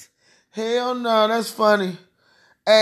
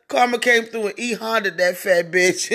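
words breaking into real laughter; helpless laughing. Real, not acted. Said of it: "I cannot stop laughing".

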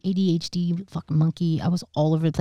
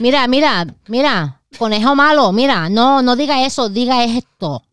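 louder, higher scolding abuela voice; scolding grandmother; 5 semitones higher, 5 dB louder than usual. Retold or imitated. Impersonating her grandmother in the studio, a loud scold. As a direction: scold like an old Puerto Rican grandma.

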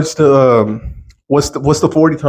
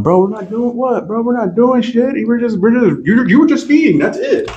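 high, heated mock protest; retold protest; 10 semitones higher than usual. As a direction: argue with the cop, pitched up. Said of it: "I'm like".

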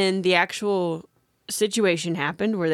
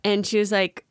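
ordinary talk

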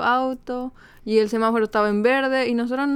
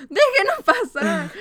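high, drawn-out retold demand; laughing, slow high-pitched mock plea; 8 semitones higher, slower than usual. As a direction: imitate a pleading demand, stretched out high. Acts out her plea to policeman.

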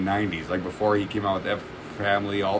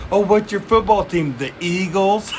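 high-pitched jeering question; mocking taunt; 9 semitones higher, 3 dB louder than usual. Retelling Bill Burr mocking Philly's team, jeering. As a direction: jeer it as a comic imitation.

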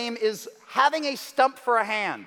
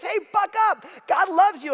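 bright, much higher mock pep talk; chirpy well-meaning cheer, pitched way up; cheery encouragement; 8 semitones higher, 3 dB louder than usual. A friend cheering up someone downtrodden, mock upbeat cheer. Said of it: "somebody says".

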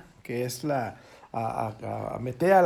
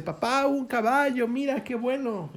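high-pitched, thrilled son showing off a find; acted-out delight, pitched well up; excited son; 8 semitones higher than usual. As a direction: imitate a boy calling out happily to his father. Son delighted at found horse, retold.

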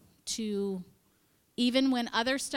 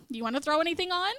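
higher, louder, fast eager pitch; gleeful; 7 semitones higher, 4 dB louder, much faster than usual. Retold or imitated. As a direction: blurt it out with mischievous glee. Gleeful bonfire invitation.

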